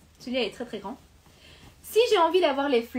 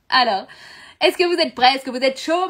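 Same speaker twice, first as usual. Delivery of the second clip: hyping the viewers; 6 dB louder than usual. Real, not acted. Pumps up live viewers.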